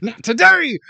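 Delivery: loud, high defiant retort; triumphant refusal. Retold or imitated. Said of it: "I'm like".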